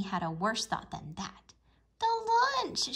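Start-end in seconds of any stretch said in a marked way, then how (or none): 2.0-2.9 much higher, louder alarmed cry. A narrator's reading. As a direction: cry out in sudden dismay. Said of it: "she cried".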